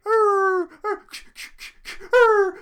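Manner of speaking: loud, high howling arr like a barking dog; imitated dog howl. Mimicking the dog howling through the house, loud and high.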